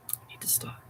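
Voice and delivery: whispered aside to himself. Real, not acted. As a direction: breathe it out as a whisper.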